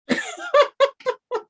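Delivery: high, loud burst of laughter; real amused laugh. Real, not acted. Laugh at own story.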